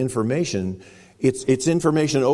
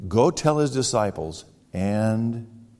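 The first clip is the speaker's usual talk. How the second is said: drawn-out Go tell his disciples and; slow it down to set up the last word; slow, deliberate; slower than usual. Shown as a demonstration. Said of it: "did you get the other part of that?".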